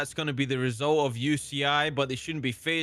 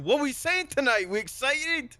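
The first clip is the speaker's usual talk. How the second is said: hype call; 8 semitones higher than usual. Real, not acted. Hyping up the co-caster.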